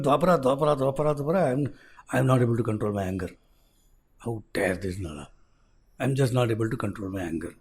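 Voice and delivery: low, soft seething anger; simmering rage held in; seething Kali. Acted.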